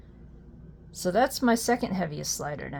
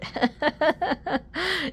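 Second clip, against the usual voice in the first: high falling burst of real laughter; surprised laughter; 9 semitones higher than usual. Real, not acted. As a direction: laugh in disbelief.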